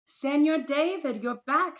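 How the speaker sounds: high, loud happy welcome; excited greeting. Acted. Boy greets returning teacher, high and loud with joy. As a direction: call out gladly to a returning friend.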